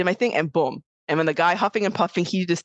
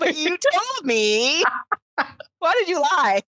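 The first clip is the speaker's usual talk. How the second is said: mock betrayed friend; 12 semitones higher, slower than usual. Mock hurt friend, laughing.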